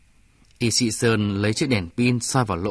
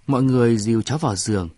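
plain reading voice

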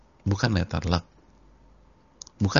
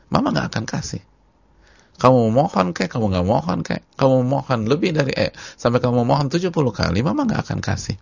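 higher, louder scolding mother; insistent mother; 5 semitones higher, 4 dB louder than usual. Acted. Mother telling child begging is useless, insistent scolding. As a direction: drive the refusal home, clause after clause.

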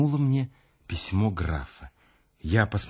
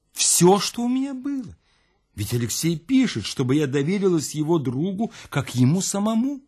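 insist, voice climbing high; high agitated defence; 8 semitones higher than usual. Acted. Louise defending herself to the narrator, high and agitated. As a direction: pitch soaring, insisting.

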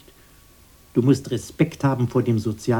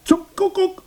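exasperated outburst. Acted.

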